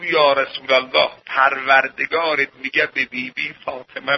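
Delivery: low, softened narration and dialogue; hushed sermon storytelling. Acted. Preacher retells Gabriel's message to Fatima, hushed storytelling.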